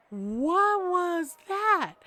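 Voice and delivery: drawn-out, high, loud astonishment; baffled. Retold or imitated. Boyfriend asking her about the scene on the train, baffled. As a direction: stretch every word out in mock bewilderment.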